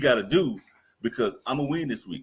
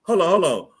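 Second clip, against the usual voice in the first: excited; 9 semitones higher, 7 dB louder, faster than usual. Real, not acted. Stops the playback excitedly.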